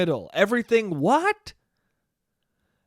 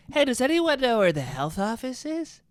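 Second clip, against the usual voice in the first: creepy vice principal; 5 semitones higher than usual. Retold or imitated. Mimicking vice principal asking room.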